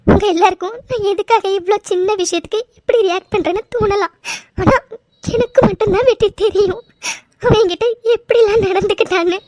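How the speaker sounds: tearful, with sobs between phrases; crying. Acted. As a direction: pour out your hurt through sobs. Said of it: "please, நீங்க அழாதீங்க".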